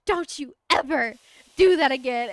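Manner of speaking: loud stern warning from an outraged mother; angry scolding. Retold or imitated. Mom grabs her daughter's arm, loudly scolding. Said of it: "grabs my arm and goes".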